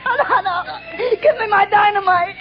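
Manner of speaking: sobbing, shouting in frantic despair; crying madman. Acted. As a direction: rave through tears at being tricked. Captured madman raving, crying.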